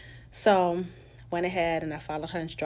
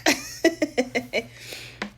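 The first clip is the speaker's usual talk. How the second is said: high loud laugh; 9 semitones higher, 7 dB louder than usual. Real, not acted. Remembering the festival mix-up, loud laughter. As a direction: burst out laughing.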